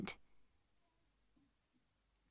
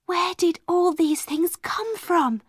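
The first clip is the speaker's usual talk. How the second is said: amazed; 7 semitones higher than usual. A narrator's reading. Sara amazed at new things.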